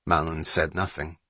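usual speaking voice

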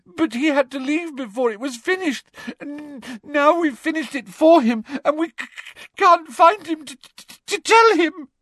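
high, stammering wail; hammed-up tearful confession; gasping sobs. A narrator's reading. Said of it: "gasped Hermione".

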